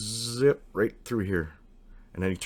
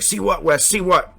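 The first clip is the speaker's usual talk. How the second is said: high, loud irritated "see what"; impatient shout back; 11 semitones higher, 9 dB louder than usual. Retold or imitated. Friend shouting back at Wes from the cabin, loud and impatient. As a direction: bark it back from below, annoyed.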